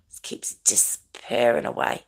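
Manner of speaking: whispering under breath; breathy unvoiced mutter; whispered aside. Real, not acted. Muttering about hair aside.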